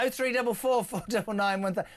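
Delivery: ordinary talk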